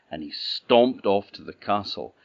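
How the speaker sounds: plain reading voice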